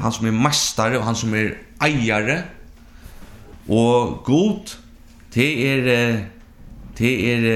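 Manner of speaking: loud, emphatic pulpit voice; fervent preaching. Acted. Preacher explaining God's names to congregation, emphatic preaching. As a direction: proclaim each name of God.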